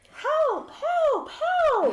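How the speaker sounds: high, loud, drawn-out calls; cry for help. Acted. Archeon calls out from outside at night, high cry for help. Someone cries for help in story.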